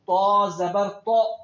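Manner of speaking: slow sung تو زبر تو with a heavy ط; sing-song drill, heavy and slow; full-mouthed heavy ط. Shown as a demonstration. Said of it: "منہ بھر کے".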